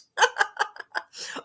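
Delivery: unvoiced high laugh; sheepish airy giggle; breathy giggle. Real, not acted. Surprised at the time.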